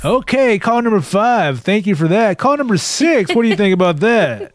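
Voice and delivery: over-the-top DJ taking calls, laughing; talk show host. Pretend. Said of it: "It's your talk host voice".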